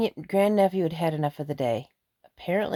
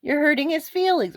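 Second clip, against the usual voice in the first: pleading protest; 10 semitones higher than usual. Retold or imitated. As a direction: raise the voice high to stop dad hurting the boy. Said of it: "I said".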